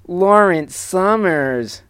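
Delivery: high, slow, drawn-out nasal whine; whiny nasal voice. Acted. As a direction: stretch the name in a whiny nasal tone. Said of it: "a weird, whiny, nasally voice".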